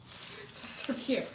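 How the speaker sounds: slow breathy whisper; whispered cooing. Real, not acted. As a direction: whisper it as if it's too cute to speak aloud. Cooing over the box.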